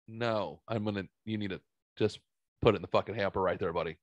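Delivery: quiet, humorless acted-out no; stone-faced refusal. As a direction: imitate an unamused nurse shutting it down. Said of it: "this guy stoned at me".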